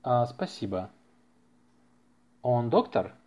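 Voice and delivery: ordinary delivery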